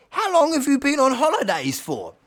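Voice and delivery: high, loud, astonished elder; Bobby. Acted. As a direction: ask it high and loud in amazement. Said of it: "Bobby says".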